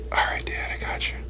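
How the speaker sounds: whispering back to dad so fish can't hear; hushed, voiceless answer; whispered reply. Retold or imitated. Whispering to dad on the fishing trip. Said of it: "I'm like".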